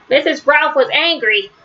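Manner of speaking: usual speaking voice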